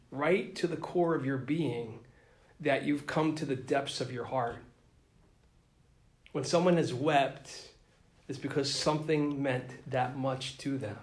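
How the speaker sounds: ordinary delivery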